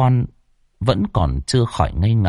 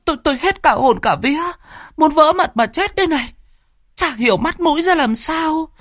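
put-on terrified old woman. A narrator's reading. Terrified wife describes ghost hands.

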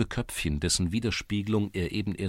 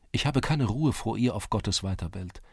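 plain reading voice

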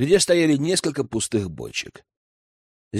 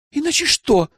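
put-on much higher, sharp girl's voice; interrupting challenge; 13 semitones higher than usual. A narrator's reading. Cornered girl interrupts the elder's threat, challenging. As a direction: cut in with a high-pitched challenge. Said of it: "перебила она".

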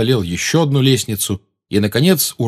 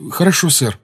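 energetic; 8 semitones higher than usual. A narrator's reading. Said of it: "энергично кивнул Гарри".

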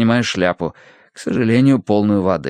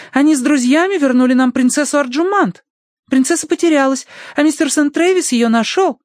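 very high piping explanation; girlish daughter, eager; 3 dB louder than usual. Acted. Daughter defends the stranger to papa, eager. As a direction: plead his case in a girlish voice.

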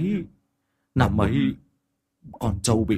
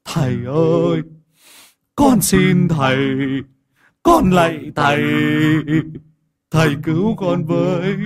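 desperate pleading; 3 semitones higher, 4 dB louder than usual. Acted. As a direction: beg the master for mercy. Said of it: "quỳ xuống mà lạy cậu Tẩy lia lịa". (ông Định van xin thầy, giọng nài nỉ to)

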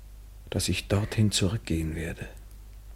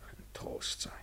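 whispered echo; 21 dB quieter, slower than usual. Acted. Repeated vow, then echoed.

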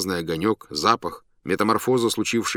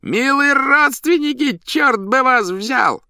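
booming circus ringmaster; 7 dB louder, slower than usual. A narrator's reading. Clown performing for circus crowd.

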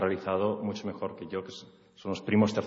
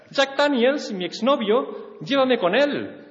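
very high, slower, hammed-up wail; mock wailing; 14 semitones higher, 4 dB louder, slower than usual. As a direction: whine for the ex-boyfriend, overdone. She wails for her ex.

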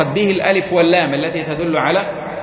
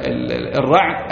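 usual speaking voice

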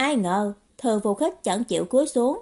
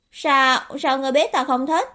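higher, louder, stammered question; flustered surprise; 4 semitones higher, 4 dB louder than usual. Acted. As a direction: caught out and flustered. Bối rối vì bị nói trúng. (bối rối, lắp bắp hỏi lại)

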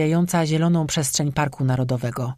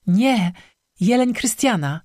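high, upset correction; acted distress, pitched up; distraught; 6 semitones higher, slower than usual. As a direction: correct them while badly rattled. Distraught girl correcting her friend.

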